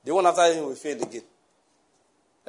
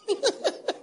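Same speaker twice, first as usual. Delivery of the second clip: shrill burst of scornful laughter; high mocking laugh; 6 dB quieter than usual. Mother laughing at the neighbor's witch claim. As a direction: cackle at the accusation. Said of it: "She started laughing".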